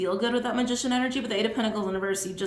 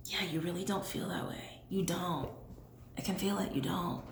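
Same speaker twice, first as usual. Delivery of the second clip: soft unvoiced whisper; 2 semitones lower, 9 dB quieter than usual. Real, not acted. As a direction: whisper it close and intimate. Intimate reading, whispering.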